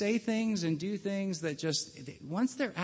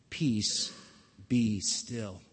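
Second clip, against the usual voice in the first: calm command; 6 semitones lower, much slower than usual. Retold or imitated. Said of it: "spoke with that voice".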